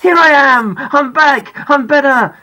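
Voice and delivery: loud, high and joyful shout; triumphant shout to the class. Pretend. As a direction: burst back into the classroom yelling. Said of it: "and shout out".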